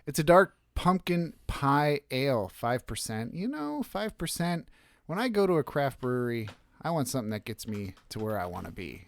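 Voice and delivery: ordinary talk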